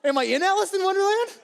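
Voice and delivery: high, loud, laughing astonishment; stunned wonder at a lavish buffet; amazed disbelief. Retold or imitated. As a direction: gasp at a dream come true. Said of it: "I'm like".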